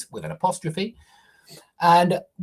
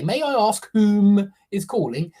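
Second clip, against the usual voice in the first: a light posh put-on for "May I ask whom is calling?"; mock posh; 4 semitones higher, 5 dB louder than usual. To students: teacher mimics hypercorrect posh speakers, lightly. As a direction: play a would-be posh caller, lightly. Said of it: "they're just trying to sound posh".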